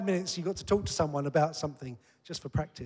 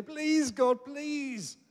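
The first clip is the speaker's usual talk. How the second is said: high-pitched mock begging; drawn-out, hammed-up plea; pleading; 9 semitones higher, much slower than usual. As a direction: plead as if trying to persuade God. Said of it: "plead with him and say".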